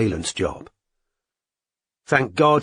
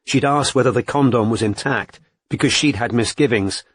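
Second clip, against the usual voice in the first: usual speaking voice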